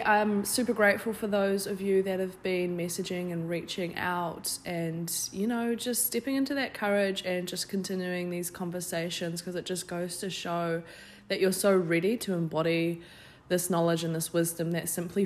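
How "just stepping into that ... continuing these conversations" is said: ordinary delivery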